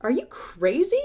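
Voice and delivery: loud, scornful shout; a woman shouting in disbelief. A narrator's reading. Woman in the crowd to the stranger, shouting scornfully. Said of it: "shouted a woman".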